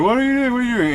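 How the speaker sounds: high incredulous classmates' outcry; shocked shriek. Retold or imitated. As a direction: yell it high, as the whole class gasps. Class yelling at the chair-lifting.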